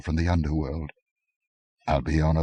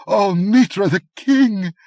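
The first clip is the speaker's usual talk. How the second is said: much higher, louder cry of relief; joyful shriek; 14 semitones higher, 8 dB louder, slower than usual. A narrator's reading. Countess freed, shrieking with relief. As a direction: cry out to her god in relief. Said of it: "shrieked Albiona".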